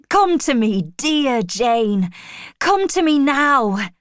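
loud, eager summons; urgent calling. A narrator's reading. Rochester calls Jane into his arms, urgently. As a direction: call out with rising urgency.

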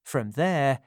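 usual speaking voice

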